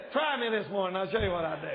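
usual speaking voice

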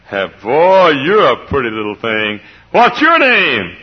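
high, loud, slow doting greeting; old preacher greeting a child. Retold or imitated. Old preacher cooing at a little girl, high and loud. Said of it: "and Doctor Bob said".

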